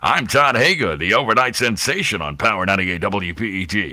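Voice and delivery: hammed-up radio announcer station ID; imitated self-important on-air voice; Todd Hager. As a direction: swing the pitch like an overnight DJ. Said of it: "He would start talking like that".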